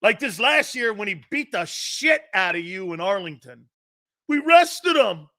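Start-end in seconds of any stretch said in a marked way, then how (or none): 4.3-5.3 high, loud, slow sarcastic whine; mock fan excuse. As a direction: drag it out mockingly. Mocking Eagles fans' excuse.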